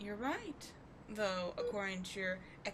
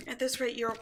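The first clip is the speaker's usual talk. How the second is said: normal talking voice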